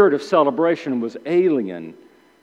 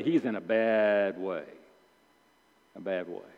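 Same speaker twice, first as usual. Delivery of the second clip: country saying; 9 semitones lower, 10 dB quieter than usual. Retold or imitated. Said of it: "you'd say".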